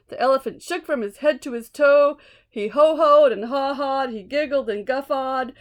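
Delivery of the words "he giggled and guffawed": usual speaking voice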